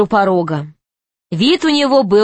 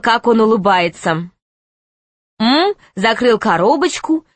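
usual speaking voice

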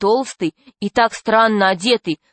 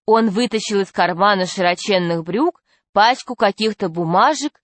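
ordinary delivery

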